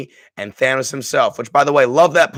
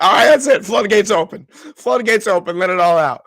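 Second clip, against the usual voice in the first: overwhelmed outburst; 5 semitones higher than usual. Retold or imitated. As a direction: let it burst out. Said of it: "getting emotional".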